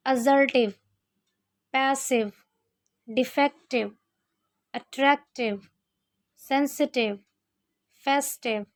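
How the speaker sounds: -ive endings with a short इ, as in एक्टिव; पैसिव, एक्टिव end in a short इव; example words read out. Shown as a demonstration. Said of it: "छोटी ई की तरह पढ़ते हैं".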